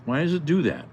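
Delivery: real irritated exclamation; exasperated. Real, not acted. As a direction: vent at the stubborn computer. Import failed again.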